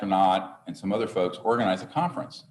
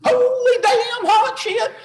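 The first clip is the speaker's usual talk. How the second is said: much higher, slow, squealing Southern yokel yelp; Southern dude impression; 9 dB louder, slower than usual. Retelling a funny video to the audience, a high squealing Southern yelp. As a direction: yelp it like the excited Southern guy in the viral video. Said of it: "where the Southern dude goes".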